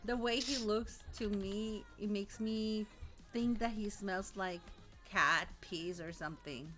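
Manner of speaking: normal talking voice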